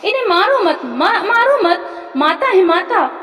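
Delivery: put-on high, urgent boy's plea; narrated cry, pitch leaping, words stretched, without a full shout. A narrator's reading. Son begging father not to shoot his mother, crying out. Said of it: "लड़के ने चिल्ला के कहा".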